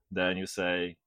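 ordinary talk, nothing marked

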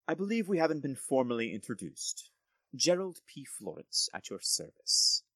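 lightly voiced courteous nobleman, bargaining; a hint of an oily noble negotiating; smooth lord Gerald. Gerald introduces himself.

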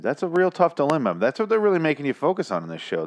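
ordinary talk

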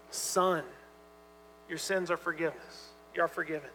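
soft, gentle words of pardon to a son; tender authority. Retold or imitated. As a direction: speak it tenderly, like a father forgiving. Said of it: "and tender".

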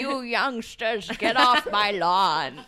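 put-on slow grumpy old man. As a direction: creak it out like a cranky grandpa. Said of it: "It's a great old man voice".

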